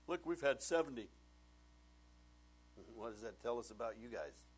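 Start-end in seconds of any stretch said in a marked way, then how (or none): 0.1-1.1 mock boast. Quoting the proud Baptist church.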